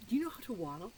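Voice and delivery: ordinary talk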